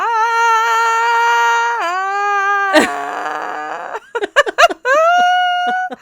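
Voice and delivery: high, loud unhinged cackle; manic laugh. Real, not acted. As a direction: laugh like you've lost it. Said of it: "accompanied by my rocking back and forth like an insane person".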